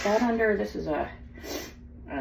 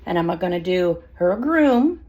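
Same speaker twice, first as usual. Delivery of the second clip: normal talking voice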